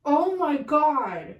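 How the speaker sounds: slow, drawn-out awe sinking to a hush; overwhelmed to tears. Real, not acted. Reader struck by a huge revelation, tearfully awed. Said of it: "I'm in tears right now".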